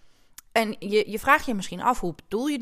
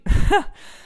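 short laugh; 10 semitones higher, 7 dB louder than usual. Real, not acted. Laughs at own hoarseness.

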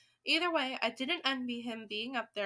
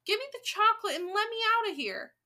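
high, loud demand to leave; pitched-up insistent kid; shouting; 9 semitones higher, 5 dB louder, faster than usual. A narrator's reading. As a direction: demand it like an alarmed boy. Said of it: "shouted Bruno".

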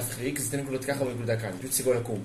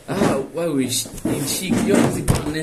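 disgusted outburst; 4 semitones higher, 9 dB louder than usual. Real, not acted. Recoils at the word form.

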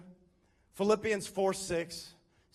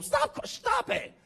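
high, slow scolding that grows louder; mock exasperated parent; 7 semitones higher, 5 dB louder, much slower than usual. Parent to crying kids who aren't hurt, mock scolding. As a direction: order a tantrum to end, hammed-up.

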